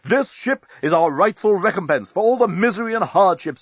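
high, indignant declaration; emphatic, aggrieved speechifying; defensive. A narrator's reading. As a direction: justify it like a wronged captain. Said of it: "appeared a trifle defensive".

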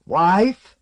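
put-on cracked old bass call. A narrator's reading. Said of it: "in his cracked bass voice".

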